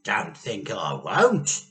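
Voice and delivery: slow, loud menace; Grandma threatening. A narrator's reading. Grandma warns she will drink it. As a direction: growl it as a dare. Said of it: "Grandma said".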